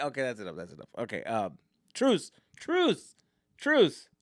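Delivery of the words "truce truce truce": calling truce. Real, not acted. Calling truce in mock fight.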